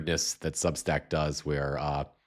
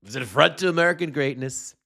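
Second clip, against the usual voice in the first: scornful echo of the phrase, pitched up; mocking repeat; 8 semitones higher, 3 dB louder than usual. Host mocking Trump's words to viewers, scornful. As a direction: sneer the words back at him.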